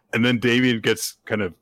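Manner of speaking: normal talking voice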